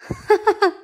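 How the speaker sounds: high chuckling laugh; hearty laugh. Acted. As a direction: laugh through the mustache. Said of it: "se reía a través de sus gruesos bigotes".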